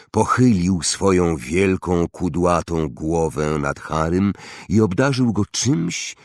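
ordinary delivery

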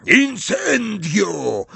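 high, long-drawn cry; shouted spell. A narrator's reading. Big Death Eater setting the hut alight, shouting. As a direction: bellow the incantation slowly. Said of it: "krzyk wielkiego śmierciożercy".

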